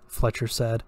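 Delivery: plain reading voice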